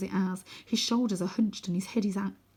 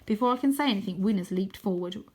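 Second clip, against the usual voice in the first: ordinary delivery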